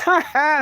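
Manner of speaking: sharp high mocking ha ha; mock laugh. Trickster gloats over the man he tricked, mocking laugh. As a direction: laugh right in his face, gloating. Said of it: "I'll laugh in your face".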